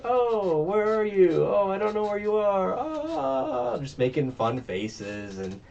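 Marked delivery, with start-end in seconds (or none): 0.0-3.8 high, loud taunting falsetto; mocking clown singsong. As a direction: tease the hiding victim. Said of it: "being like".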